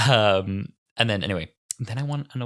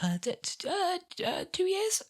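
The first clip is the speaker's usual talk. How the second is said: very high, faltering stammer; hesitant; 4 dB quieter than usual. Retold or imitated. Host unsure how long the podcast has run, stammering high. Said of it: "I'm like".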